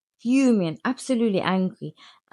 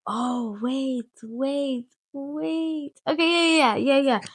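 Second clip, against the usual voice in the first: sudden realisation; 8 semitones higher, much slower than usual. Real, not acted. Catching herself mid-story.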